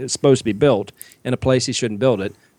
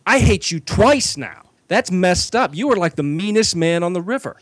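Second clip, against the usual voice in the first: whiny complaining star; 7 semitones higher than usual. Retold or imitated. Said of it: "So he was like".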